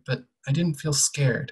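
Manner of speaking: ordinary delivery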